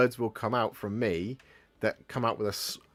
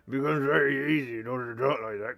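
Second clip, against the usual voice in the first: gravelly Gloucester burr with every R extended; thick West Country Gloucester growl; gravelly Gloucester accent; 2 semitones higher than usual. Shown as a demonstration. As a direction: talk Gloucester out of one side of the mouth, R's rolling. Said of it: "you add some gravel like that".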